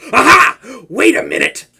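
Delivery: high, loud burst of discovery; sudden realization. Acted. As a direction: snap to attention, loud and high. Villain suddenly recognizes the captive.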